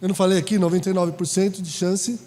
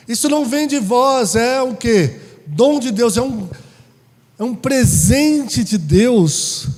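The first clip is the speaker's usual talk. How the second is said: loud, fervent preaching; full-voiced pulpit preaching; 7 dB louder than usual. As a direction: preach it out to the congregation. Opens loud on Ephesians.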